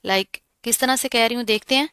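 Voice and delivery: ordinary talk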